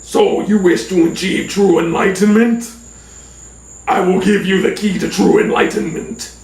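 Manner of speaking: grand mystical oracle voice; talking rock. Retold or imitated. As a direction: pronounce it like an all-knowing rock. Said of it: "look at me and go".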